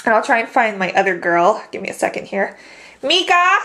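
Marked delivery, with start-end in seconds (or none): 3.0-3.6 high call for her; calling the dog. Real, not acted.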